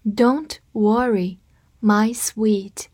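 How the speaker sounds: slow, drawn out words; slow read. Shown as a demonstration. Teacher reads slowly for learners to copy. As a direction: say it slowly for students. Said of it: "跟着我慢读一遍".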